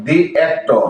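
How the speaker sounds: "the" pronounced dee before "actor"; long ee vowel in "the". Shown as a demonstration. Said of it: "এটা উচ্চারণ করতে হবে দি".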